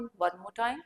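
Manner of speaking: ordinary talk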